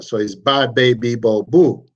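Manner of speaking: "ba, be, bo, boo" each vowel pure and open, teacher demo; Swahili syllables ba be bo boo. Shown as a demonstration. Teacher modelling "ba, be, bo, boo" for a class. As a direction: spell out "ba, be, bo, boo" crisply, one syllable at a time. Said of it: "very easy to pronounce".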